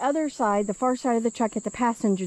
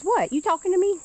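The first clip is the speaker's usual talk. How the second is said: mock indignant; 10 semitones higher than usual. Real, not acted. Talking back to a frog.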